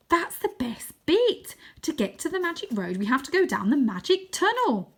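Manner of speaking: put-on boy thrilled to share his secret; excited boy Wynne. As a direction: relish the big reveal. Voices Wynne in story.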